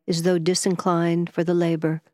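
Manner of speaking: ordinary delivery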